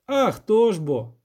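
drawn-out peasant reply; rustic village elder. Acted. Elder answering the witcher, emphatic rustic voice.

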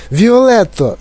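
high-pitched alarmed call; crying out. A narrator's reading. Mother calls out to Violet, crying out. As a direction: call her name in horror. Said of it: "закричала пані Борегар".

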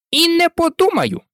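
high-pitched, indignant refusal; offended refusal. A narrator's reading. Mouse refusing Alice as it walks off, high and offended. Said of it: "Ти ображаєш мене".

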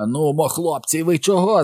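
high, bewildered yell to friends; crying out. A narrator's reading. Henry, on his back, yells to his gang, high outcry. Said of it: "закричав він".

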